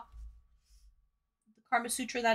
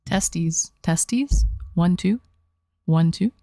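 booming low mic check; loud mic check; 5 semitones lower, 7 dB louder, much slower than usual. Shown as a demonstration. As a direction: belt it into the mic. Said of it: "My favorite mic check".

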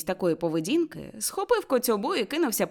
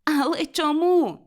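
slow, high, louder cry; 8 semitones higher, 5 dB louder, slower than usual. A narrator's reading. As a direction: cry out in disbelief. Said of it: "закричав племінник". (вигук здивування)

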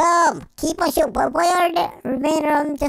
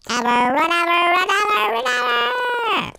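mock wail; 6 semitones higher than usual. Dramatic wail about leaving forever.

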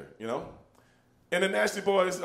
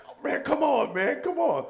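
high, pained mock protest; a winded kid begging them to stop; whining kid; 9 semitones higher than usual. Matt Damon after the punch, high whiny mock voice.

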